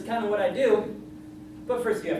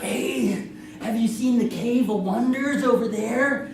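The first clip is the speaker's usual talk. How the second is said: creepy guy; 2 semitones higher, 3 dB louder, slower than usual. Retold or imitated. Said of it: "creepy guy that came up like".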